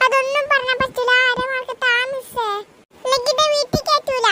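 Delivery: high whining plea for half a mark; whiny pleading child. Acted. Pupil begs the teacher for half a mark, high and whiny. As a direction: beg like a little girl close to tears. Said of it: "ഇവിടെ ഒന്ന് കരഞ്ഞിട്ട് കാര്യമില്ല".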